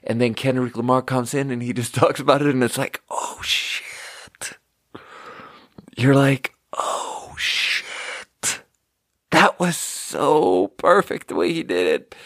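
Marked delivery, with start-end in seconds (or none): 3.1-4.6 breathless whispered oh shit; hushed stunned whisper; awed gasp. Retold or imitated. Reacting in awe to the song. 6.8-8.6 whispered oh shit again, hushed; hushed stunned whisper; awed gasp. Retold or imitated. Repeating the awed reaction.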